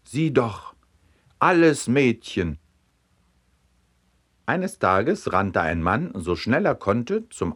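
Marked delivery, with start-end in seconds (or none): 0.0-2.6 higher, enthusiastic exclamation; thrilled, pointing out a discovery; excited call. A narrator's reading. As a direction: call it out in delighted amazement. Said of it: "und ruft begeistert".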